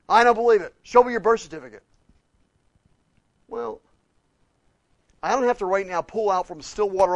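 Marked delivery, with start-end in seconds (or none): 0.1-1.8 loud, raised preacher's voice; full-force preaching. Acted. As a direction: drive the point home to the congregation.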